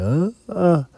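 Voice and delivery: high-pitched mock yell, short cries; imitated yell. Dreamer showing how he yelled as he woke up, imitated yell. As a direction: imitate crying out high on waking. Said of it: "yelled, like".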